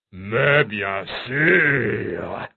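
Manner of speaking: very slow, relishing dragon voice; dragon licking its chops. A narrator's reading. Dragon accepts a new challenger, slow and relishing. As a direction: draw each word out hungrily. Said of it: "répondit-il en se léchant les babines".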